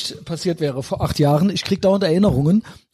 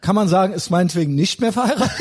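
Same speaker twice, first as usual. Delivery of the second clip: ordinary talk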